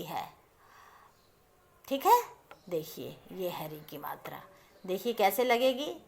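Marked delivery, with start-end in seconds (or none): 2.7-4.4 hushed, showing the sign; whispered aside. Shown as a demonstration. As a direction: whisper it while pointing at the board. Teacher showing the matra. (फुसफुसाकर दिखाते हुए)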